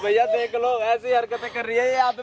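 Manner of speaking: tearful appeal to a brother; weepy tattling to the brother; crying complaint. Acted. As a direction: call out, half crying, for him to look. Calling brother to see shameful act.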